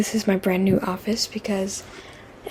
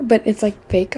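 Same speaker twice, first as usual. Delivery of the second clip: ordinary delivery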